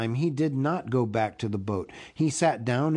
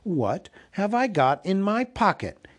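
higher, more insistent question; said louder; 7 semitones higher than usual. A narrator's reading. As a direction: put the question firmly, pitched up. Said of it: "he said louder".

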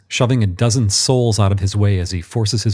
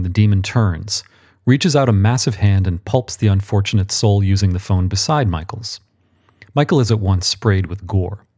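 plain reading voice

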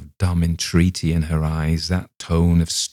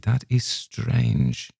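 slow and pondering; 6 semitones higher, 4 dB quieter, much slower than usual. A narrator's reading. Rabbi muses.

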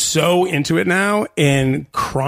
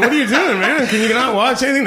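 high-pitched, hammed-up complaint; exasperated questioning, pitched well up; mock exasperation; 8 semitones higher than usual. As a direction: protest in lightly acted disbelief. Mock exasperation over the broken TV.

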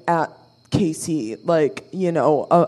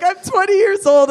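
shocked protest; 12 semitones higher, 4 dB louder, faster than usual. Retold or imitated. Reacting to the kiss.

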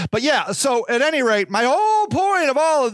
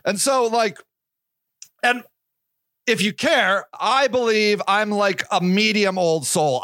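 ordinary talk

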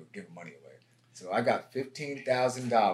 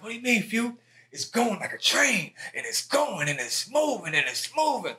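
high, loud mock [name]; imitating [name]; 12 semitones higher, 5 dB louder than usual. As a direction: hype it up way higher and louder, selling the track. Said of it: "And he was like".

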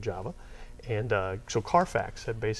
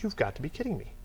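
higher, softer mock outrage; exasperated disbelief; 6 semitones higher, 5 dB quieter than usual. Interviewer to graduate who never built a database, exasperated disbelief. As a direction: retell your stunned exasperation, holding it back. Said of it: "I lost my mind".

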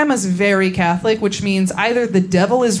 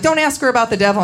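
fast and a bit higher, mock worried aunts; rattled-off aunt warning, higher; quick, hushed warning; 4 semitones higher, faster than usual. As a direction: hurry the warning out like a nervous aunt. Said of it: "They're like".

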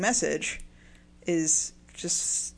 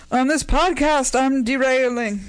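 loud, higher, drawn-out mock recital; mock poetic declaiming; 6 semitones higher, 8 dB louder, slower than usual. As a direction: belt it out slowly as a parody verse. Parodies the poem's line, laughing after.

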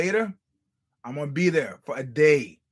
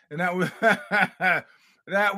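laughing; 3 semitones higher, much slower than usual. Real, not acted. Laughing through remark.

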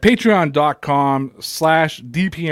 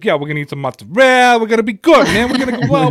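hammed-up New Jersey Italian guy, louder and higher; Jersey Shore Italian; 7 semitones higher, 5 dB louder, faster than usual. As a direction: swagger it like a Jersey Shore cast member. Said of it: "I was walking around like".

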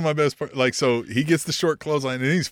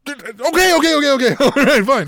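higher, louder flustered giving in; mock placating; 11 semitones higher, 7 dB louder than usual. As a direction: nervously give in, hands up. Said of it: "And he's like".

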